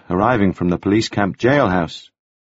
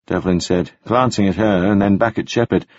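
usual speaking voice

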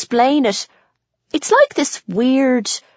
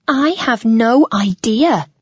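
theatrical. A narrator's reading. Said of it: "Janine declares theatrically".

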